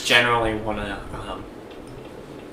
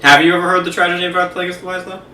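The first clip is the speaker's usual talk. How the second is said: quick, higher, tossed-off question; casual retelling; 6 semitones higher, faster than usual. Acted. Co-host asking friend about the legend, quick and chatty. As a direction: rattle it off like casual chat. Same line redone as casual chat.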